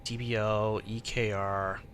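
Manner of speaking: ordinary talk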